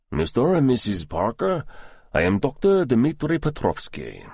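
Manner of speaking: put-on doctor's greeting, lightly Russian-accented; a lightly voiced Russian doctor introducing himself; thick Russian accent. A narrator's reading. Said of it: "He spoke with a thick Russian accent".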